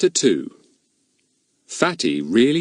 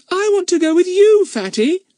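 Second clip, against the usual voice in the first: put-on high, eager little girl; little girl pleading; 6 dB louder than usual. A narrator's reading. Bets begging Fatty to take her, high and eager. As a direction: beg to tag along.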